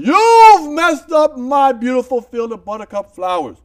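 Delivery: slow, booming, high-pitched yell; Mother Nature. Retold or imitated. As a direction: thunder it out from the sky in outrage. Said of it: "Mother Nature yelled".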